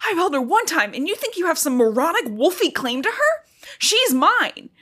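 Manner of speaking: put-on hammy furious mother claiming her baby; movie-line outrage. Quoting Bella's furious movie line to her friend. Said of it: "the exact lines from the movie".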